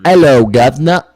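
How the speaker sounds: mock British accent. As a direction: ham up a cockney greeting. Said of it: "That's the closest I could do".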